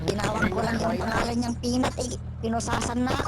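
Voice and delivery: high, exasperated protest; indignant complaint. Real, not acted. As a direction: protest the arrest in disbelief. Complains about being handcuffed.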